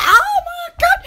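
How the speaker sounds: high-pitched startled exclamation, laughing; retold shock. Speaker retelling the storm to viewers, high mock shock. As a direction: re-enact being startled, pitch leaping up. Retelling own reaction to thunder.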